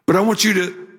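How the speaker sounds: ordinary delivery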